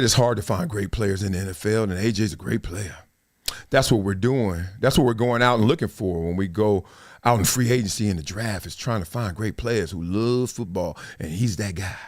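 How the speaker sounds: low, soft mock exec voice; lightly voiced bland executive answer; quoting Howie. Mimicking the GM's dull press answer. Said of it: "He said".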